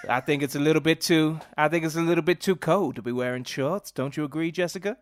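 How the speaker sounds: hammed-up Southern American accent, stage-actor delivery; theatrical fake American accent; bad American accent. Shown as a demonstration. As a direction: act it out in a deliberately annoying American accent. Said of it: "in a bad American accent".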